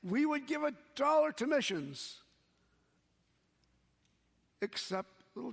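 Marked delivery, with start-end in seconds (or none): 0.0-2.2 put-on high, eager children's shout; Sunday school kids shouting yes to their teacher, excited. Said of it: "again they shouted".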